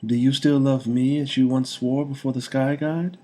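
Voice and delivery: high, loud tender lady's voice; reminding him of their bond; loving young woman. A narrator's reading. Woman reminds the king of their magical bond.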